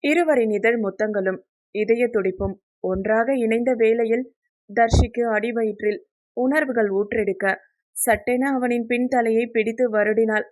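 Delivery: ordinary delivery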